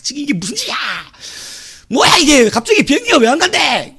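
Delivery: high, loud indignant passenger protest; angry outburst. Retold or imitated. Stranded passengers protesting the cancelled flight, angry outburst. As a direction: imitate an outraged traveller yelling.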